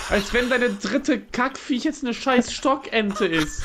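loud high outraged yell; outraged shout. Real, not acted. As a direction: yell it in furious disbelief. Outraged at partner's kill.

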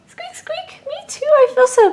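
tiny critter squeaking before saying it feels bad; tiny squeaky creature. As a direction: squeak like a little pet, then fret. Tiny toy creature squeaks, worries.

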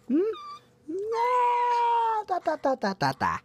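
high playful babble falling away. Real, not acted. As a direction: babble nonsense to the pet. Babbling at the pet.

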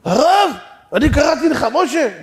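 loud, high protest; flustered passer-by at high volume; acted alarmed protest. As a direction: cry out in mock horror.

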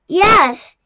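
loud happy shout; excited cry. A narrator's reading. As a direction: cry it out with relief. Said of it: "they cried".

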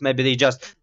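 ordinary talk

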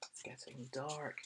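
hushed spooky whisper. Acted. Reading to children, whispered aside. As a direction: whisper to build suspense.

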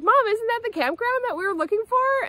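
put-on kid voice. Kids' voice quoted.

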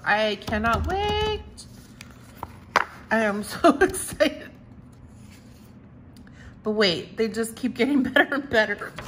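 giddy and bubbling over; excited. Real, not acted. Thrilled about new products, laughing.

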